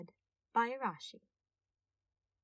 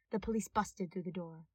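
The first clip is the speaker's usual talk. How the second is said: ordinary delivery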